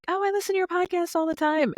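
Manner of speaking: high-pitched, quick imitation of a listener; imitated podcast fan. Listeners greeting the podcast host, imitated fan voice. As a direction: mimic a fan, voice lifted well up. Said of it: "They're like".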